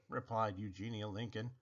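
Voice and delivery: ordinary delivery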